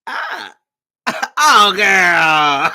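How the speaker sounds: put-on loud embarrassed laugh into oh girl; a busted friend laughing on the phone; caught laughing. Mario caught lying, laughing.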